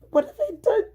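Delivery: high, loud, voice cracking into a cry; tearful plea. Retold or imitated. Retold plea after shove.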